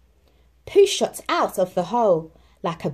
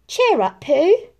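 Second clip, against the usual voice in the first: reassuring; 9 semitones higher, 6 dB louder than usual. Acted. Christopher Robin comforts Pooh.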